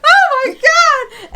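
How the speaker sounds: high, slow, drawn-out panicked cry. Retold or imitated. Girl scratched by the cat cries out in panic. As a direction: squeal it out in alarm.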